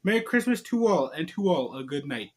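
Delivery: plain reading voice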